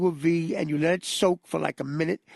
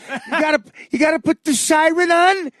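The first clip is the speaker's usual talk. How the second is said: much higher, louder exasperated yelling; Dice yelling; 11 semitones higher, 7 dB louder than usual. Retold or imitated. Dice interrupted by fire trucks, yelling exasperated at them. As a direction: bellow at the passing fire trucks. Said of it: "he turns into Dice for a second".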